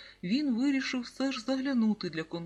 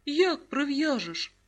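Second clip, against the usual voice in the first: higher, louder, drawn-out question; surprised; 6 semitones higher, 5 dB louder, slower than usual. A narrator's reading. Boy puzzled by mum tying numbers to her head, surprised. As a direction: ask in astonishment, stretching it out. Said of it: "здивувався Сергійко".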